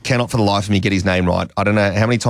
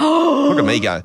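high, drawn-out self-congratulation; gleeful boast; slower than usual. Real, not acted. Presenter celebrates finally saying the name, gleeful. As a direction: crow over your success, pitched way up.

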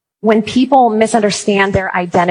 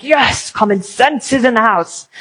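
gleeful cheer; 2 semitones higher than usual. Retold or imitated. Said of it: "I'm like".

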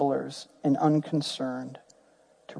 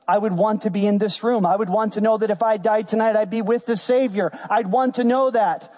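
raised-pitch, insistent preacher delivery; impassioned preaching; 6 semitones higher than usual. Acted. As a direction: press each point home to the congregation.

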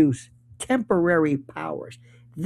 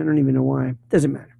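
usual speaking voice

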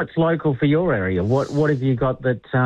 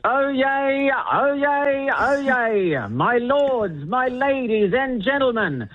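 high, drawn-out crier proclamation; town crier's shout; 10 semitones higher, slower than usual. Shown as a demonstration. Demonstrating an announcement for the mayor, town crier's call. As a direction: call it out in long, high ringing phrases. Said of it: "shout something like".

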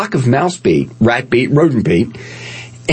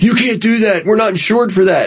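higher-pitched mock objection; raised-pitch retold reprimand; scolding company voice; 9 semitones higher than usual. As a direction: imitate a fussy employer telling you off.

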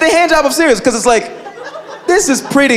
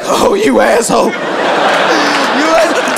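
mock outrage; 4 semitones higher, slower than usual. Mock outraged pizza receiver.

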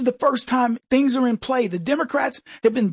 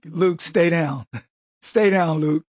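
George Kennedy impression; 5 semitones lower, slower than usual. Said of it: "George Kennedy and Cool Hand Luke".

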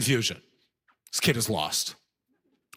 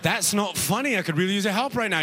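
put-on higher, hurried British-accented protest; annoyed British person; 6 semitones higher than usual. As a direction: object urgently as a lost Brit. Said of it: "They're like".

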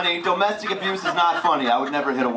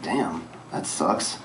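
deadpan; 9 semitones lower, 6 dB quieter, much slower than usual. Retold or imitated. Deadpan reaction.

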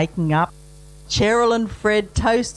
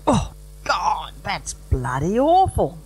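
bloke at the wedding. Retold or imitated. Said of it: "he goes".

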